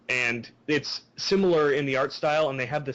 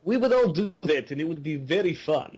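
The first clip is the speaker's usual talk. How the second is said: put-on high hammy Russian accent; fake Russian accent; 4 semitones higher than usual. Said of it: "our fake Russian accents".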